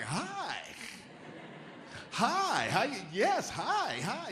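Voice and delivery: high, bright-eyed "Hi". Retold or imitated. Mock Midwestern greeting to a stranger. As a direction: greet like an overly friendly local.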